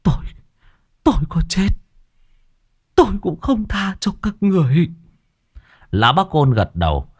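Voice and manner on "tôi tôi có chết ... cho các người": high, weak, dying rasp; weak wheezing. A narrator's reading. The dying boy cursing his killers, weak and wheezing. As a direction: spit out a last curse with no strength left. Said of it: "yếu ớt khò khè".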